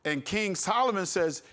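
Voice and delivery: normal talking voice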